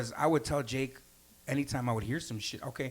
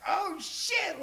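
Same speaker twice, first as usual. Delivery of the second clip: high squeaky alarm; a high mock-panicked yelp; startled. Realizes he's losing the fight.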